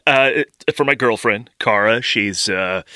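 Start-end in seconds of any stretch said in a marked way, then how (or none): none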